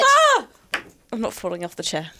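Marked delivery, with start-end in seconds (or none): 0.1-0.5 sudden high shriek; high scream. Acted. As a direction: shriek as if falling. Mock scream during charade.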